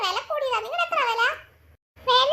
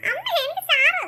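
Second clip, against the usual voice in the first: higher, put-on childish wail; tearful whining; 5 semitones higher, 4 dB louder than usual. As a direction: plead with mother as if crying. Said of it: "എന്തിനാ കുട്ടി കരയുന്നേ?". (കരഞ്ഞു വാശിപിടിക്കുന്നു)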